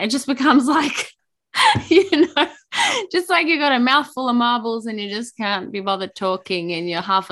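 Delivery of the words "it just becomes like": ordinary delivery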